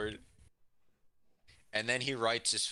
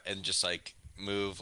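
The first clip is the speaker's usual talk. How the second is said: usual speaking voice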